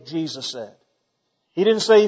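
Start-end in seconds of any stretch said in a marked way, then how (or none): none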